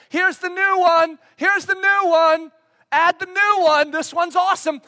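hammed-up eager crier; excited proclaiming. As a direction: announce it like a street crier. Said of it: "run around and proclaim".

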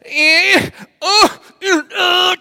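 loud high straining grunts of effort; strained groans. Retold or imitated. Dad straining over the gate, loud pained groans. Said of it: "and I go like this".

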